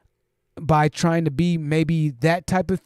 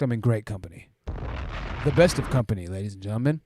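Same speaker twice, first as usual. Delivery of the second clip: radio voice; 7 semitones lower, 6 dB quieter than usual. Pretend. Host showing off his voice to the chat, deep radio announcer. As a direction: drop into a mock radio voice. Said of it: "It's not necessarily the deepness of voice".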